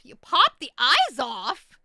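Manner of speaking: loud, drawn-out disbelief; mock shock. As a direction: shout it back, aghast and hammed-up. Shocked repeat of the remark.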